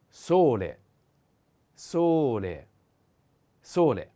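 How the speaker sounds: sole said loud, stress on o; loud teaching repetition. Shown as a demonstration. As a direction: call out sole for students. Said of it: "The accent is on o".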